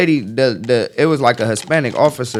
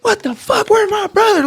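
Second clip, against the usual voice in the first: very high, frantic yell; panicked little brother; 5 dB louder than usual. Retold or imitated. Little brother searching the crowd for him, a very high frantic yell. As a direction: squeak it out in total panic.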